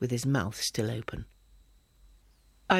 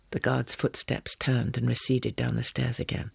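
plain reading voice

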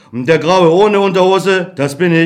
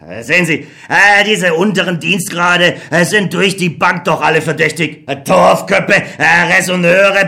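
lower, hesitant officer voice full of scorn; contemptuous colonel; 3 semitones lower than usual. Acted. Colonel sneering about drowned soldiers to Beckmann. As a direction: sneer at the lower ranks, stammering with äh.

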